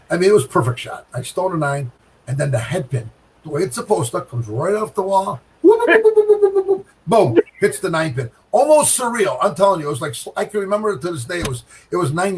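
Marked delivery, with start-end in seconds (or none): 5.6-6.9 very high, loud whoop whoop sound effect; whooping pin noise. Retold or imitated. Bowler retelling the head pin bouncing, high loud whoops. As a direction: squeal the wobble of the pin.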